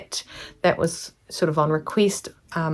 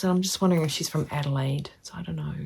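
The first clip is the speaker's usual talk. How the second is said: hushed, unvoiced musing to herself; whispered aside; 10 dB quieter, faster than usual. Real, not acted. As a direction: drop to a whisper as if thinking aloud. Whispered aside guessing her hometown.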